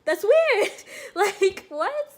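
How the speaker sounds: laughing disbelief. Retold or imitated. Recruit reacting to painless blood draw, laughing disbelief. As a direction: laugh it off in amazement. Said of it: "I was like".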